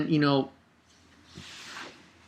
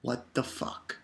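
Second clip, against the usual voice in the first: stunned whisper; 4 semitones lower, 12 dB quieter, slower than usual. Retold or imitated. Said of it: "had me saying".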